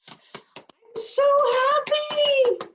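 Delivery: so happy soared very high and loud, stretched out slowly; overjoyed. Shown as a demonstration. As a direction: sing out the joy on so happy, high and slow. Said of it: "tells somebody the intensity of your feeling".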